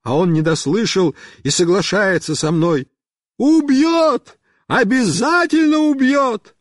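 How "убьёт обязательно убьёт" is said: high shouted agreement; high-pitched yell; calling back. A narrator's reading. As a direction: holler it back from afar. Friend shouts back, having misheard.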